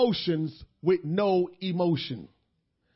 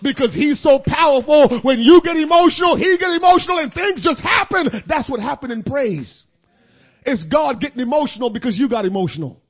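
rising preacher voice, getting louder; preacher building to a shout; building sermon shout; 10 dB louder than usual. Acted. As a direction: climb into the whoop.

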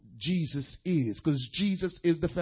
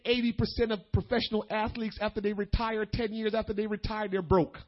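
brisk, running phrases with no pauses; quick aside. Real, not acted. Preacher tossing in a fact about retired athletes, quick aside. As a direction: rattle off the statistic in one run. Quick first statement of statistic.

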